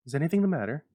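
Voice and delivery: high, loud, breathless worry; a frightened mother asking fast; anxious high cry; breathless alarm. A narrator's reading. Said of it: "she asked breathlessly".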